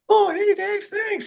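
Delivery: very high-pitched squeaky impression; high-voiced mentor. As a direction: imitate his really high voice, far above your own. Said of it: "He's got a really high voice".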